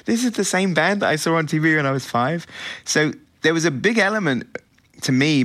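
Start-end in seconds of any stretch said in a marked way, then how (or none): 0.0-2.5 high-pitched wow of recognition; retold amazed rediscovery. Music fan rediscovering Sparks after years away, retold amazement. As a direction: imitate a fan's surprise, pitched well up. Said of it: "be back in your life and go".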